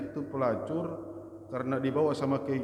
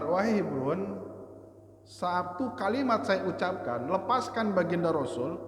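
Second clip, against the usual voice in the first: stern Umar; 6 semitones higher than usual. Acted. Umar demands release.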